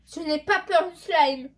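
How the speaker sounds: loud and slow, full of fake bravado; bold declaration. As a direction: boast it loudly, drawing it out. Bravely facing slime, then admitting fear.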